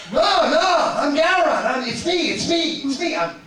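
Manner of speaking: high and loud, laughing it's me; excited insistence. Retold or imitated. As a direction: plead eagerly to be believed. Insisting to doubtful Worthy.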